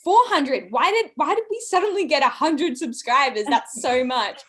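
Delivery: high astonished disbelief; shocked and confused; surprised. Retold or imitated. Shocked reply on phone.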